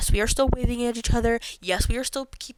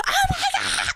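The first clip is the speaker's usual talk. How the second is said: shocked squeal. Retold or imitated.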